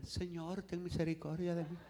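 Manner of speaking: very soft, meek little voice; mock feeble plea. Mocking a too timid Bartimeo begging Jesus, feeble. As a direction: plead weakly, barely voiced. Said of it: "gritaba como hombre".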